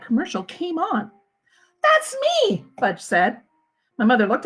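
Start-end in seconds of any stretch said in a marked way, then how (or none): 1.8-2.6 put-on much higher little-kid voice; proud small boy pointing at the TV; excited toddler. Fudge to his family about the commercial, high excited toddler voice.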